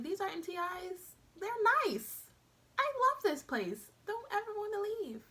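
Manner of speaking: high-pitched, gushing wide-eyed newcomer; naive delight. Retold or imitated. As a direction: squeal with mock delight, stretching the praise. Naive first impression, acted out.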